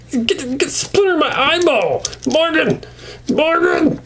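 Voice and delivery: high cartoon panic, yelling the name again and again; hammed-up yelling. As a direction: squeal in mock outrage about a splinter. [name] yelling at Morgan in skit.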